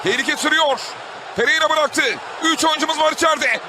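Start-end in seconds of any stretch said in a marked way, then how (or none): none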